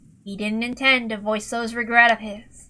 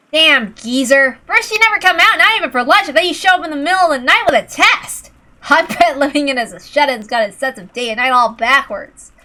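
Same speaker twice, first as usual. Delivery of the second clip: put-on much higher, loud griping young man; complaining; 5 semitones higher, 9 dB louder than usual. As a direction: whine loudly about the old man. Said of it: "Complaining all the way".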